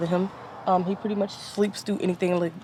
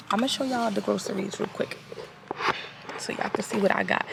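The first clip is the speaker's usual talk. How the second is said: whispering; 6 dB quieter than usual. Real, not acted. Baby napping nearby.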